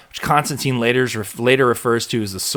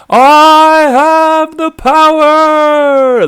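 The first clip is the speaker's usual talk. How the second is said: very slow, much higher and louder heroic battle cry; He-Man; 12 dB louder, much slower than usual. Retold or imitated. Podcaster joking the sword is Grayskull's, heroic slow bellow. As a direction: stretch it out like a cartoon hero raising his sword. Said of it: "It does look like He-Man's sword".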